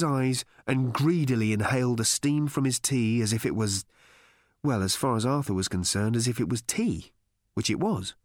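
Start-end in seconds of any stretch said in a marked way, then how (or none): none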